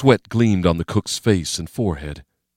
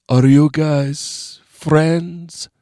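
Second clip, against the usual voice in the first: high, slow, drawn-out question; a dazed sick man asking; sick cook voice; 9 semitones higher, much slower than usual. A narrator's reading.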